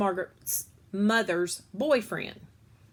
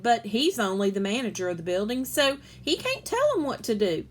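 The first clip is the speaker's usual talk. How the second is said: ordinary delivery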